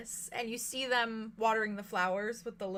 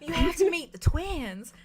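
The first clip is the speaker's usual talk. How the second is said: eager invitation; 6 semitones higher, 8 dB louder than usual. Acted. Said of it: "she's like".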